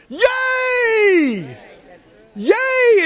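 very high, long shouted squeal; joyful squeal. Acted. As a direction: squeal like a child getting a present. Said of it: "You squeal and go".

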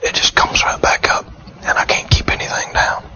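imitating a frail patient in a faint whisper; weak and sick. Cancer patient telling the visiting minister they can't eat, weak whisper. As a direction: whisper it feebly, with long rests between phrases. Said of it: "they just so pitiful, weak and poor".

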